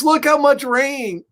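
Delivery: high-pitched astonishment at a downpour; amazed exclamation. Acted.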